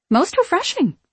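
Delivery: high, airy delighted quip; pleased quip. A narrator's reading. Mary tasting the water, quipping. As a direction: say it high and tickled.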